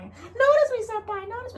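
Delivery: hammed-up high fangirl plea; mock anime fan plea. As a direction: beg like a gushing anime fan. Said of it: "I don't know if you've heard that video".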